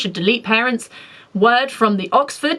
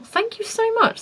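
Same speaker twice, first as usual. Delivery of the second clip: delighted thanks; 12 semitones higher, 3 dB quieter than usual. Real, not acted. Touched by a kind comment.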